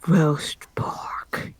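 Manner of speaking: breathy hungry whisper; a hushed longing for food. Acted. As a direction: whisper it like a secret craving. Whispered in-character.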